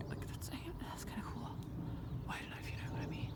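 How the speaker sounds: hushed awe; whisper. Real, not acted. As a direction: whisper so they don't hear. Whispering near the animals.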